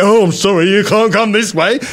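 lightly put-on apologetic official voice; mock unhelpful attendant. Mimics unhelpful barrier attendant.

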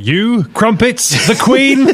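high, slow, exaggerated questioning; hammed-up clueless foreigner, pitched high; mock ignorant tourist. Mocks American's questions about London.

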